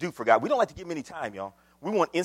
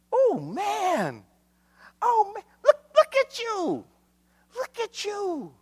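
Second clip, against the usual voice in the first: drawn-out, very high exclamation; keening, stretched-out cry to the church; high-pitched wail; slower than usual. Acted. Mock wailing amazement in sermon.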